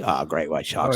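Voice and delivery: low, soft Australian warning; Australian accent. Retold or imitated. Australian comic warning visitor off the beach, Australian accent. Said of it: "It's a good Australian accent".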